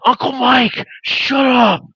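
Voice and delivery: high, whiny mock-nephew plea; mortified protest. Nephew begging his uncle to stop embarrassing him near girls, mortified.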